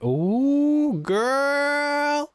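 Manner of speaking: high, loud sassy squeal. Real, not acted. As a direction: squeal it like catty gossip. Reacting to a catty dialogue option.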